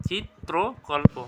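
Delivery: "চিত্রকল্প" slowly as চিত্-ত্রো-কল্পো; syllable-by-syllable pronunciation. Shown as a demonstration. Teacher repeating for students, "চিত্রকল্প" slow and split. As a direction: separate each piece of "চিত্রকল্প". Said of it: "এটা কী হবে".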